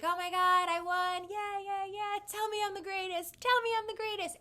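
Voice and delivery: high, loud, sing-song victory gloat; silly gloating. Retold or imitated. Teasing her date after beating him at cornhole, high mock gloat. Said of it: "being silly and jolly".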